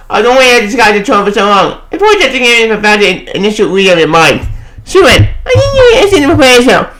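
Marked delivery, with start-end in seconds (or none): 4.8-6.9 higher put-on character voice; a lightly voiced comic hero. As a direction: read the line as a story hero. Voicing a character from book.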